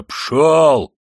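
high, loud, forceful shout; shouted dismissal. A narrator's reading. Big yardkeeper throwing Raskolnikov into the street, furious shout. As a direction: roar it to throw him out. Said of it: "крикнул другой дворник".

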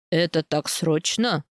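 slow, low, mildly surprised question; low-pitched, unhurried puzzlement. A narrator's reading. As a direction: ask with restrained surprise, taking your time. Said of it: "удивлённо спрашивает он".

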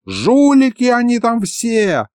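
put-on high, drawn-out old sorcerer's cry; outraged wail. A narrator's reading. Old sorcerer Likhovid denounces the academy, high indignant wail. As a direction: cry out accusingly, high and stretched. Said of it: "завопил из-за монитора Лиховид".

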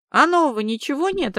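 high, loud curious question. A narrator's reading. Man asking his caller for news, curious. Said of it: "осведомился мужчина с любопытством".

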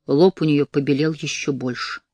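ordinary delivery

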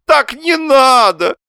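very high, loud, drawn-out protest; exclaiming. A narrator's reading. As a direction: wail it against her calm words. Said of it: "воскликнул Лоханкин".

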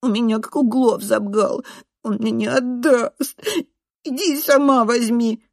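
high, whiny, sulky complaint; whimpering boy. A narrator's reading. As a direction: whine it, R mispronounced. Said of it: "хнычет Ренат". (хнычет, картавя)

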